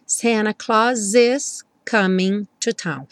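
slow, "Claus" S joined to "is"; linked Claus is. Shown as a demonstration. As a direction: glide "Claus is" together. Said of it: "o S do Santa Claus já juntou com is". ("Claus is" ligado, devagar)

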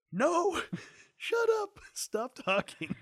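put-on high, loud laughing yell at the kid; dad playfully yelling at his son to stop talking, high and loud; mock yell. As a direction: squeal shut up through laughter.

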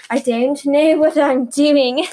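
loud, slow, badly faked British; awful British accent. Podcaster mocking her own attempt, awful British accent. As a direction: drag it out in a hammed-up British accent. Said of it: "it sounds awful".

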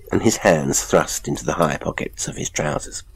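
usual speaking voice

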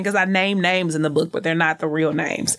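usual speaking voice

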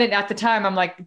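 ordinary talk

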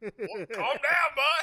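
slow, laughing mock news anchor; smart-ass anchor. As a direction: drag it out smugly while laughing. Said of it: "he is such a smart ass".

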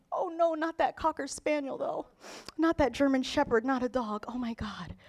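hushed, hammed-up mourning; imitating a heartbroken pet lover, very soft; mock grief. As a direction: lament quietly, fading away in pretend sorrow. Mimics pet lover grieving a dog.

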